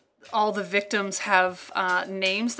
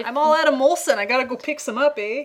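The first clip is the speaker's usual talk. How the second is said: higher-pitched, hammed-up Canadian accent; mock Canadian accent; 5 semitones higher, 4 dB louder than usual. Teasing the Canadian about Molson, mock Canadian accent. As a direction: play a cartoon Canadian, tagging on "eh". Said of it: "think of a Canadian thing to say".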